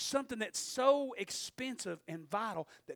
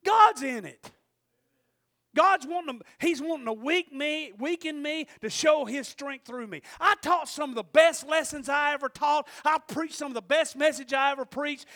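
loud, raised testimony with punched phrases; forceful preaching; 8 semitones higher, 13 dB louder than usual. Real, not acted. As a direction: preach the story hard to a whole room.

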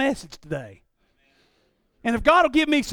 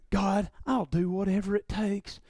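mock pious prayer; 3 semitones lower, 9 dB quieter than usual. Mock pious prayer, empty words.

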